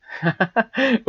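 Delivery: light laugh at own slip; embarrassed laugh. Real, not acted. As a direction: laugh off the mistake. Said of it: "Oops, I did it upside down".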